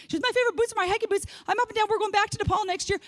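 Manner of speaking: high, gushing mock enthusiasm; excited sister. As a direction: mimic the sister beaming about Nepal. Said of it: "She's walking all up and over".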